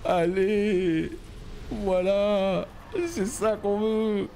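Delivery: high, drawn-out urging; excited urging. Real, not acted. Gamer cheering his way to the boss kill, excited. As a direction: egg it on, high and stretched.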